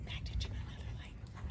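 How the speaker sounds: hushed, hurried whisper; whispered aside. Real, not acted. Caller to companion about the lights, whispered aside. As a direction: whisper it quickly under the calls. Murmured aside between calls.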